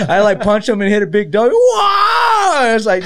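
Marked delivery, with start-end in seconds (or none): 1.5-2.6 a loud, high-pitched mock yell of whoa; imitated yell. Telling friends how Zombie yells when he's hit, a loud mock yell. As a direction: belt out the fighter's yell as if he just took a punch. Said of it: "Why does he yell like that?".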